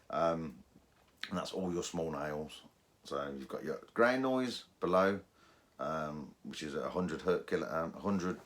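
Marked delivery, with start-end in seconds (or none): none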